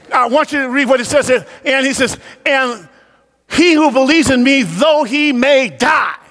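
high, driving preacher's voice; full-force preaching. Real, not acted. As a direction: preach it hard, pitched well above talk.

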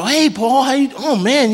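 mock-delighted hello to a friend; gushing greeting. Preacher acting out someone greeting an acquaintance, high and gushing. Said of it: "they start complimenting you".

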